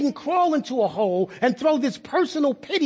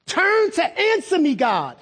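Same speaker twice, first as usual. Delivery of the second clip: high-pitched demand falling on the last word; upset, impolite demand; 5 semitones higher than usual. Acted. Upset David demanding God's answer, high and impolite. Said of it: "I don't think he said that really politely".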